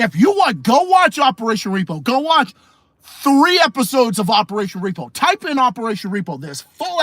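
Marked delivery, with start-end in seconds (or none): none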